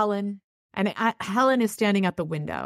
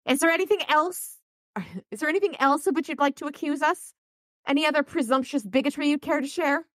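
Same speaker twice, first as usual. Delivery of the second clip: scornful; 11 semitones higher than usual. Acted. Priscilla scorns Dresden.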